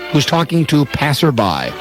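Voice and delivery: ordinary talk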